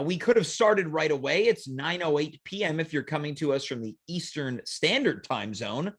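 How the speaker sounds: normal talking voice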